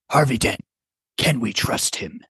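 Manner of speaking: half-hearted superhero impression; lightly voiced vigilante, holding back; Batman. Player taking a turn in a voice game, understated Batman. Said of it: "having us do our Batman voices".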